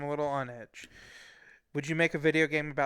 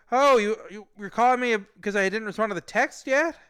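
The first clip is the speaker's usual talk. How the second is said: nervous, flustered; 7 semitones higher, 8 dB louder than usual. Retold or imitated. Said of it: "he made me very nervous".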